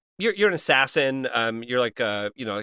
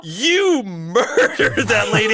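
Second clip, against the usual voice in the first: laughing; 6 semitones higher, 6 dB louder, much slower than usual. Retold or imitated. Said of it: "I was like".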